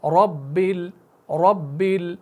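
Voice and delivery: रब्बिल with र said thick, full-mouthed; heavy ra. Shown as a demonstration. Teacher drilling the heavy ra for students. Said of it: "मोटा करके पढ़ते हैं".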